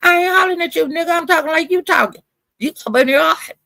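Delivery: high-pitched, insistent comeback; heated retort. Retold or imitated. Retelling his comeback to being accused of hollering, heated. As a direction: argue back hotly without really shouting. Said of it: "Why you hollering at me?".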